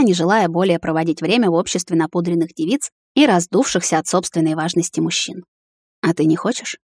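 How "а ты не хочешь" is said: ordinary delivery, nothing marked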